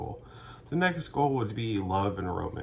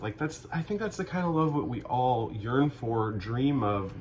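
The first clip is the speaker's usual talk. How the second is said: usual speaking voice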